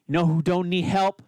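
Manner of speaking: ordinary talk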